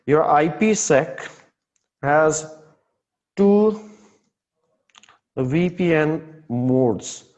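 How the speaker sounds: slowed down with pauses between words; slow, spaced emphasis. Shown as a demonstration. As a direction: spell it out word by word slowly. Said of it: "here I'm telling here".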